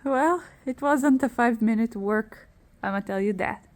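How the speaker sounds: ordinary delivery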